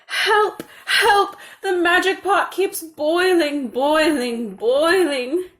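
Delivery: high, loud desperate cries; calling for help. A narrator's reading. Mother at the friend's house calling for help, frantic and high. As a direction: call out in panic. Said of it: "she called".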